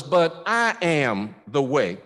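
"I" punched, slow and falling to "the way"; slow down and hit "I"; stress on I. Shown as a demonstration. Preacher insisting to the congregation that Jesus alone is the way. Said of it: "I'm talking about just me".

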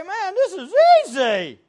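loud, high, pleased exclamation; mock delight. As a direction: exclaim like someone delighted by easy wealth. Acts out people enjoying new wealth.

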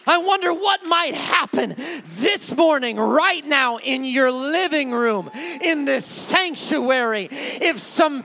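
loud, emphatic sermon appeal; full-force preaching. Real, not acted. Preacher urging living rooms and sanctuary, forceful preaching. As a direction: drive each phrase out to the congregation.

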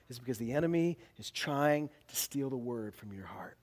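dramatic pulpit whisper. Real, not acted. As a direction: lean in and whisper the warning. Preacher drops to a whisper.

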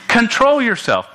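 high-pitched, frank command; blunt admonishment. Real, not acted. Preacher repeating his frank order to the congregation, blunt and high. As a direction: drive the order home bluntly. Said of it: "let me put it frankly".

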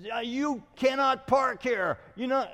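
high, loud mock shout; imitating angry neighbor. Angry neighbor yelling at pastor over parking, imitated shout. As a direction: bark it at him like the angry neighbor. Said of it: "he comes out and yells at me".

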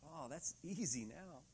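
ordinary talk

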